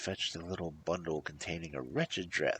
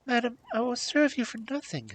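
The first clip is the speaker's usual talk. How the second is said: high, mildly dejected plea; a touch of despair, pitched up; dejected princess; 7 dB louder than usual. A narrator's reading. Princess offering to work for nothing, mildly dejected. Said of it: "spirits were sinking lower and lower".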